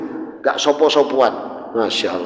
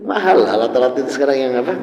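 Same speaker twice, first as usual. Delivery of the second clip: ordinary talk, nothing marked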